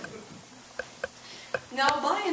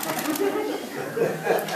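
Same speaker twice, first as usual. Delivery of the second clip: giggle; 6 semitones higher than usual. Real, not acted. Reader laughs.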